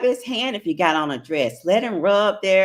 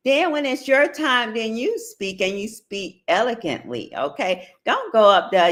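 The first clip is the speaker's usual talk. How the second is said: normal talking voice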